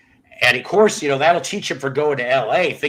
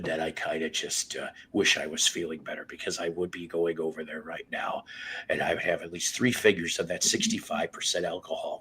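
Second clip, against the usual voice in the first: much softer, half-voiced confession; hushed whisper; 8 semitones lower, 9 dB quieter than usual. Real, not acted. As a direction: drop into a hush like a secret. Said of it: "I don't know why we're whispering".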